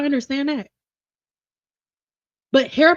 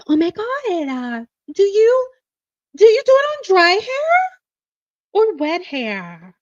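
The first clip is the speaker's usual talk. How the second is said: rising, higher and louder eager question; polite caller; 5 semitones higher, 5 dB louder than usual. Retold or imitated. To the stylist, a high eager polite question. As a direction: ask sweetly, eager and bright. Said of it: "redo of polite caller".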